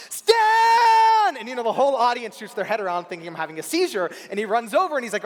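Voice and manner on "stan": full-lung, high yell of a name; one long, loud, high-pitched holler. Retold or imitated. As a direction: scream the name out at the top of your lungs. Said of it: "I started yelling at the top of my lungs".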